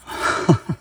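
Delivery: short, high burst of laughter; disbelieving laugh. Real, not acted. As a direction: laugh in amazement at the find. Overwhelmed by his incredible find.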